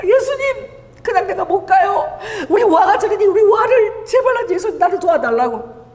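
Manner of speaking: high, pleading cry to Jesus; half-laughing retold plea; mock pleading prayer. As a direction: beg Jesus to let you stay for your daughter.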